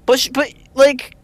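usual speaking voice

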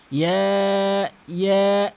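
ইয়া drawn out long for three alif; ইয়া stretched for three beats. Shown as a demonstration. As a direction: hold ইয়া long, as in recitation. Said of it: "এই যে টেনে পড়লাম তিন আলিফ".

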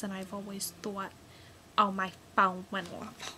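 ordinary talk

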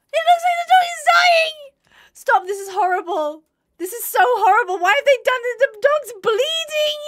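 high, loud, near-tearful cries; distraught wailing. Real, not acted. Player watching her dog bleed, loud distraught wailing. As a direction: wail over the dying dog.

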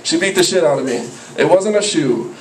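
ordinary talk, nothing marked

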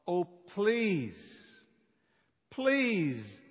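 normal talking voice

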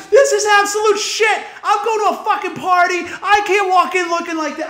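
high-pitched furious rant; losing his shit. Retold or imitated. Customer raging in front of the whole shop, losing it. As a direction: re-enact a public meltdown at the barber. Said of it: "I fucking lost my whole shit".

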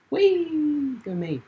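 high, loud, drawn-out whee; gleeful high-pitched cheer; playful squeal. Pretend. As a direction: squeal it like you're on a ride. Playful cheer during gameplay.

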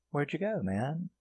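high, soft teasing falsetto; mock-sweet taunt in a woman's voice. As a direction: imitate her needling him over the phone. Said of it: "starts taunting him".

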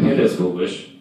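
usual speaking voice

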